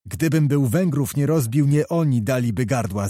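ordinary delivery

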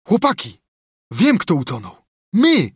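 high calling out to the gang; excited shout. A narrator's reading. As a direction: cry out a sudden revelation, peaking on the last word. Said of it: "Zawołał". (wysoki, podekscytowany okrzyk)

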